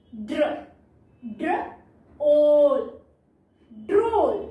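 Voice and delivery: phonics-style "ड्र - ओल - ड्रोल"; sounded out. Shown as a demonstration. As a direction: blend "ड्र" into a stretched "ओल". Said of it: "long sound देता है".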